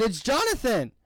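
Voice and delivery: high, insistent retold clarification; baffled self-introduction.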